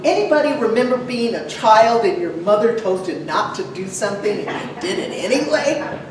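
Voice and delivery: ordinary talk, nothing marked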